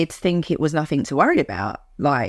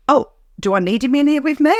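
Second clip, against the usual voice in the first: higher-pitched mock questioning; joking; 7 semitones higher than usual. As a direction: ask it lightly, as a joke. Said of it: "said it like that, like joking".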